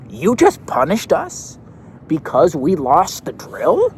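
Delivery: put-on upset kid voice. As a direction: play the wronged kid.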